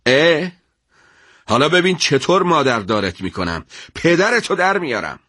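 sergeant's furious threat; angry. Acted. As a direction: snap back with a threat at the prisoner. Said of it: "با عصبانیت گفت".

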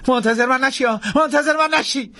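high-pitched repeated call; frantic shout. Acted. Jackson answering Imabelle from the floor, frantic shout. Calling back while fleeing.

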